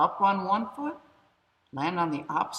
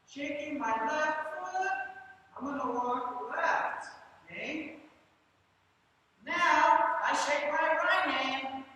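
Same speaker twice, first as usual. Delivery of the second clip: moving while talking; 10 semitones higher than usual. Shown as a demonstration. Teacher demos walking zigzag, high voice.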